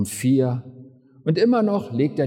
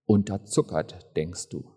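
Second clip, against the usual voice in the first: normal talking voice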